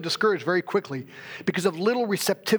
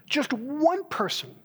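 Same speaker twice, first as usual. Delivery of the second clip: higher, slow, aching plea; pleading prayer; 7 semitones higher, slower than usual. Retold or imitated. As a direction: lift the voice as if begging God for one soul. Retold prayer to God.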